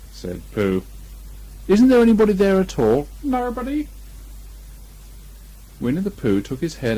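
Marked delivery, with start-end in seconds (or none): 1.7-3.1 high, loud bear voice; Pooh calling. Acted. Pooh calls into the hole again, high and loud. 3.2-3.9 prim little rabbit, put out; Rabbit's voice. Acted. Rabbit scolds Pooh from inside the hole, high.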